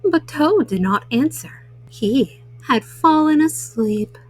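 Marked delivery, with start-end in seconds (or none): none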